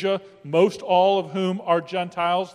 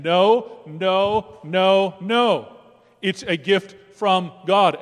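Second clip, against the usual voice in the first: emphatic voice of God; 2 semitones higher, 6 dB louder, much slower than usual. Acted. Preacher voicing God's reply.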